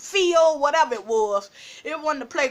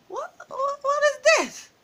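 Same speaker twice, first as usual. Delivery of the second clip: high, stammered disbelief, acted out; retold shock in a high-pitched voice; 14 semitones higher than usual. Finding her boyfriend with another girl, acted shocked disbelief.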